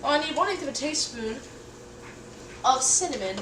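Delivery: ordinary delivery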